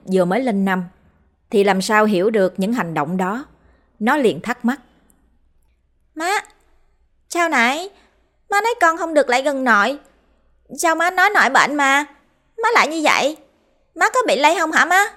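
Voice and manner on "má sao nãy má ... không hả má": put-on much higher, puzzled child voice; an innocent five-year-old asking; small boy's voice. A narrator's reading. Five-year-old son questions his mother, high child voice.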